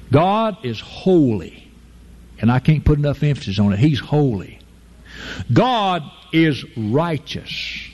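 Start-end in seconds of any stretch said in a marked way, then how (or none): none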